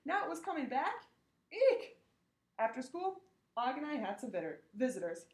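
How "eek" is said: high, loud squeaked eek; tiny startled shriek; frightened squeak. Acted. Hamster frightened by memory.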